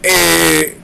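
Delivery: hammed-up drawn call; Sonny. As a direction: belt it out in a put-on voice. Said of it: "He's like".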